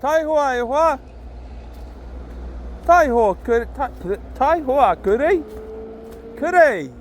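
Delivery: calling the dog. Real, not acted. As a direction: call the dog home across the bush. Calling his dog in the park.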